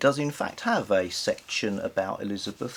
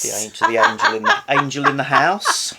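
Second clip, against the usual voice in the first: louder, quick, words breaking into laughter; laughing; 4 semitones higher, 9 dB louder, faster than usual. Real, not acted. Host laughing as she names the angel in the house. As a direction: giggle the phrase out.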